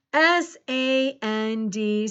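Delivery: slow, letter by letter; measured, separated letters; spelling aloud. Shown as a demonstration. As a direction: spell it out slowly for a class. Teacher spelling a word aloud.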